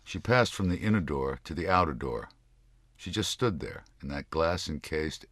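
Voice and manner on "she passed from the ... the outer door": plain reading voice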